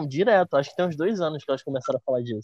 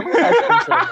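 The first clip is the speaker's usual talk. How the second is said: laughing, high and loud; 8 dB louder than usual. Real, not acted. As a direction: burst out laughing through the words. Teasing friend, laugh heard.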